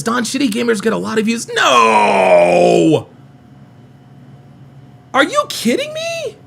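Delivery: hammed-up high outraged shout; mock outrage. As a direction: play it up like a betrayal. Reading chat, mock outrage.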